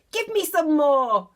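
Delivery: put-on loud, greedy old woman's cry; Grandma greedily demanding another dose. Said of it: "she cried".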